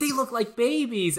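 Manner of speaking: put-on slow, high child commercial voice; kid voice from ad. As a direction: say it like a little kid in an ad. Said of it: "kids are like".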